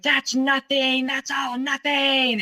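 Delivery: high, slow mock scream; imitating a scream. Ex-fiancé screaming that all she gives is nothing, high mock yell. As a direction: pitch it up and drag it out in rage. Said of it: "And he screamed".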